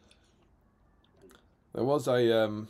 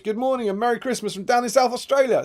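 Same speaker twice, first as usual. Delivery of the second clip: much higher, louder festive read; cheery greeting; 7 semitones higher, 6 dB louder than usual. Retold or imitated. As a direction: beam it out like a morning greeting. Reading a cheery greeting message.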